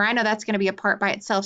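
normal talking voice

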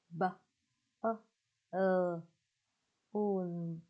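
"B-, ơ, ờ, un" as separate sounds with pauses; balloon in pieces: short "B-", "ơ", then drawn "un"; sounding out. Shown as a demonstration. Teacher teaching children balloon, sounding out "B-, ơ, ờ, un".

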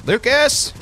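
high call with a rising end. Real, not acted. Player to Lucas who is way over there, calling out. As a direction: call the name high to someone far off.

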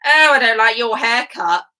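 loud dismissive complaint; rude emperor. Acted. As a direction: criticise loudly, like a snooty ruler. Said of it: "but still rude".

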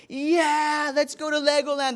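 high-pitched imitation of cheering kids; excited boys. Boys answering mom about Legoland, imitated excited cheer. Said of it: "They were all excited".

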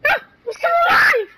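high excited shout; hyped gamer bro. Real, not acted. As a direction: yell it in triumph. Survives in game, shouts.

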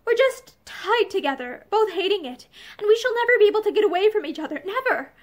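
usual speaking voice

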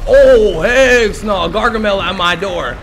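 high, loud outburst easing off; alarmed shout. Pretend. Gamer spots enemy at his door, alarmed shout.

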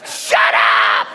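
explosive exasperated yell; silencing shout. Retold or imitated. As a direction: shout her down hard. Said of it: "I wanted to shut her up".

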